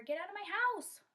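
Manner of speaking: high, loud yell; angry yell. Acted. Monkey in the tree to Kondoma, angry yell. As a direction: chase off an intruder. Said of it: "yelling at her".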